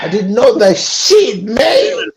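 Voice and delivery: high-pitched, drawn-out exclamation of disbelief; astonished outburst. Real, not acted. Guest learning the dashiki's real origin, astonished. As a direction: wail it high, stretching the words out.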